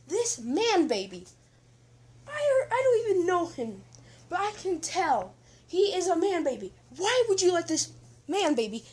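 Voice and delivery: high, loud furious outburst; outraged yell. Acted. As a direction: shout in disbelief at the man baby. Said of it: "Deku's just like".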